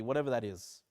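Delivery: ordinary talk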